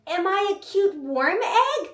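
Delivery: put-on high, slow cutesy kid question; imitated child. Child asking babysitter about himself, high slow cutesy voice. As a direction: stretch it upward like a precious little boy. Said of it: "he was like".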